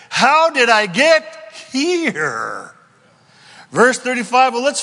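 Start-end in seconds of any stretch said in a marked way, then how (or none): 0.1-2.7 slow, drawn-out and louder; slow title line. Acted. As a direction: announce the title with weight. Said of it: "that's the title of our message today".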